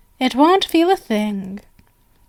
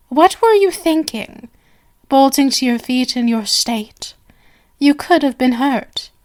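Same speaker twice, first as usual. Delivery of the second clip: usual speaking voice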